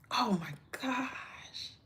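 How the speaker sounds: slow, breathless whisper; awed whisper. Real, not acted. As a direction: whisper it, overwhelmed. Moved by viewer's comment.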